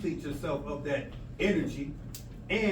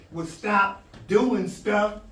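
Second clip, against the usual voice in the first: louder, dragged out stop doing stuff; slow emphatic push; 4 semitones higher, 8 dB louder, much slower than usual. Real, not acted. Teacher scolding the class, slow and loud. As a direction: lean hard on every word. Lecturer stressing the point.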